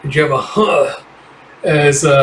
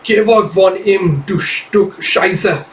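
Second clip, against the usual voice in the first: slow, higher German insult; German snarl; 6 semitones higher, much slower than usual. Acted. A fighter cursing him off her friend, in German. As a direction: spit the German words slowly. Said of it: "And Jeff".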